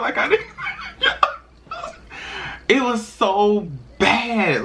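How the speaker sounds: high-pitched laugh through the words. Real, not acted. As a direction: break into a laugh while telling it. Laughing at her past crying.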